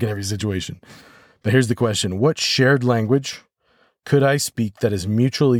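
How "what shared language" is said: usual speaking voice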